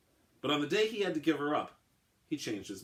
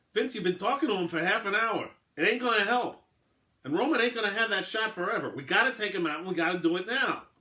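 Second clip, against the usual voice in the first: raised, higher, pushy swat boss arguing hard; gruff angry lieutenant; 9 semitones higher, 6 dB louder than usual. A narrator's reading. Lieutenant pushing Vince to take the shot, gruff and raised. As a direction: lean in hard, he wants the shot now. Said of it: "DiFilippo glowered".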